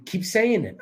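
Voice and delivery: normal talking voice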